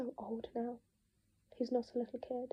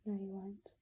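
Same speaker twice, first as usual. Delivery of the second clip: dead voice; 3 semitones lower, 5 dB quieter, slower than usual. Acted. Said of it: "His voice is dead".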